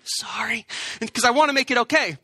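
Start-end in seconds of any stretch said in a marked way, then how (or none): 0.1-0.7 soft whispered apology; a whispered sorry nobody can hear. Shown as a demonstration. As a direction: mouth the apology, just breathed. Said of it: "and you whisper".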